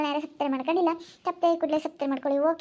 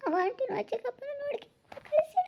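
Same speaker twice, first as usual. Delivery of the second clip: tearful wailing; 9 semitones higher than usual. Acted. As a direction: wail through the tears. Breaks down crying over fate.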